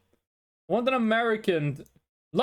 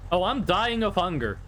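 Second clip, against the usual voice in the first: usual speaking voice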